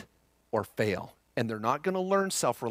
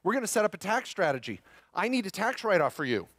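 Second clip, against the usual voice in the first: lightly voiced parent, higher, breezy and upbeat; mock parent; 7 semitones higher than usual. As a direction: play the eager parent fresh from the seminar. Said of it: "have that intervention with your kids".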